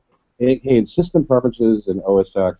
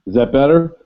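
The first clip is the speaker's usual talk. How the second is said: closer to mic; 4 semitones higher, 4 dB louder than usual. Real, not acted. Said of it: "you come in better when you're closer".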